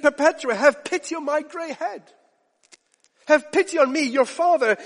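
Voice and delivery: high, loud desperate plea; pleading father. Retold or imitated. Father begging daughter Perpetua at her trial, high anguished plea. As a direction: beg her like a broken old man. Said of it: "burst out".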